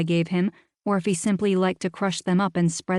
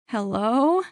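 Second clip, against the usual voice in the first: cringing hello; 4 semitones higher than usual. Acted. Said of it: "I asked with a cringe".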